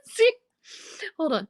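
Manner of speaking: ordinary talk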